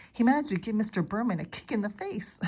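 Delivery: plain reading voice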